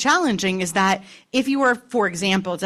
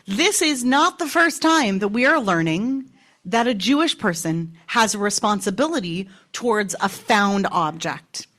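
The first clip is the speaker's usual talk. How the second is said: ordinary talk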